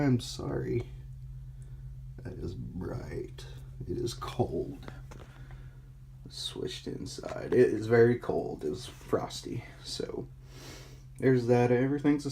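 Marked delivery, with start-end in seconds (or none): 2.2-7.5 hushed whisper, slow. Real, not acted. Filming outside in the cold night, whispering. 8.6-10.3 whispering. Real, not acted. Filming outside at night.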